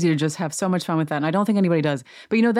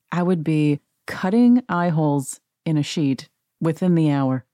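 ordinary talk

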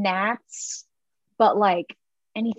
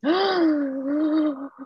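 dramatic gasp; 7 semitones higher than usual. Pretend. Mock betrayal over a quiz question.

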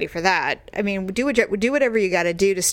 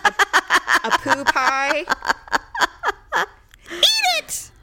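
mock pie offer; 9 semitones higher than usual. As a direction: ham up a gross-out offer. Joking about baking neighbor pie.

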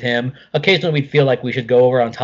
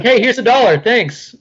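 breezy Mark impression; 8 semitones higher, 4 dB louder than usual. Imitating Mark handing out dollars.

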